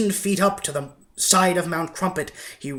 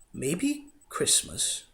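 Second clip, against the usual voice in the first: soft, slow, hushed; thinking aloud in awe; quiet wonder; 7 dB quieter, much slower than usual. A narrator's reading. The Grinch slowly realizes what Christmas means, soft and slow.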